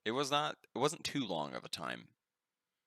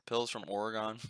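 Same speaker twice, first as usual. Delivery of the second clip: ordinary talk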